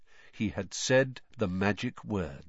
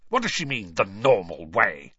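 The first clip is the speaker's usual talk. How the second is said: spat; 3 semitones lower than usual. Acted. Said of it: "he spat".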